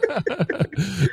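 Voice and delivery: high laugh. Real, not acted. Laughs at the DNA result.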